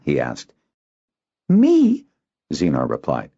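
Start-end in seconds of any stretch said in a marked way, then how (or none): 1.5-2.0 high, loud startled Me; indignant surprise; surprised boy. Acted. Boy on watch refusing the errand, surprised.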